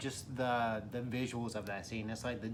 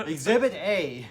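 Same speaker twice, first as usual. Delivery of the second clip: slow, loud, raised courtroom announcement; mock evidence announcement; 5 semitones higher, 10 dB louder, slower than usual. Co-host presenting dash cam footage, mock courtroom announcement. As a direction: present evidence with playful pomp.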